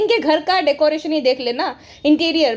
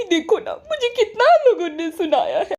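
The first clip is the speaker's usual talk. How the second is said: mock whiny wife voice, higher, with a laugh; lightly put-on pleading wife, laughing through it; a hint of a sulky wife begging her husband; 4 semitones higher than usual. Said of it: "जनाब को बोला कि".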